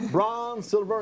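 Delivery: usual speaking voice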